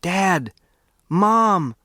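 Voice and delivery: calling out high to his parents; shouting. Acted. Boy spotting his family, calling out loud. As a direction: shout for Dad and Mom across the stream. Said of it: "I shouted".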